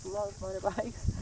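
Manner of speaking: usual speaking voice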